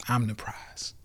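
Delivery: a smug whispered aside. Real, not acted. Boasting to viewers in a sly whisper. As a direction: whisper it like a secret brag.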